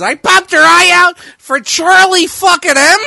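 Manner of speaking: high, loud, slowed Pesci gangster voice; Joe Pesci impression. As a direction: snarl it like Pesci in Casino. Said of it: "I had to get my Pesci voice going on".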